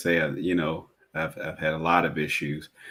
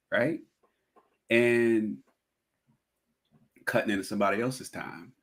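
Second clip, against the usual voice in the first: usual speaking voice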